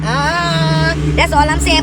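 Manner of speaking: high, loud exclamation, caught up in faith; excited outcry. Real, not acted. To her viewers about Jesus, a loud high excited outcry. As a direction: let it burst out high and loud.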